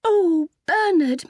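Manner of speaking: high, slow, drawn-out swoon; overcome mutter. A narrator's reading. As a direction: stretch the name out, overwhelmed and loud. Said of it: "muttered Ethel".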